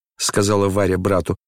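ordinary delivery, nothing marked

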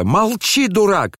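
very high-pitched command to stop; alarmed shout. A narrator's reading. Boy silencing his older brother, alarmed shout. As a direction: cry out high to silence him. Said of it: "закричал Коля".

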